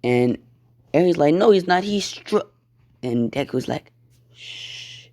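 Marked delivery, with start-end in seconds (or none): 1.3-2.5 put-on high, insistent little kid's protest; indignant child. Child protests, gets cut off. 4.3-5.1 soft, drawn-out hushing sound; shushing. Acted. Deku hushes Eri.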